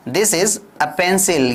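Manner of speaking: slow, clear classroom drill; slow model sentence. Shown as a demonstration. Teacher drilling simple sentences slowly for learners. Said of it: "तो आपको क्या कहना है?".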